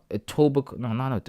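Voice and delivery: usual speaking voice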